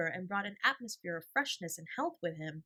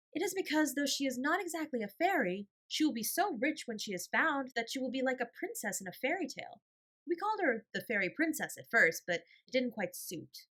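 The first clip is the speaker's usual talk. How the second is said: put-on higher child voice, earnest; young girl voice; 6 semitones higher, 4 dB louder than usual. A narrator's reading. Older sister Janet explaining to the gentleman, childlike and earnest.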